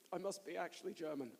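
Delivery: ordinary talk, nothing marked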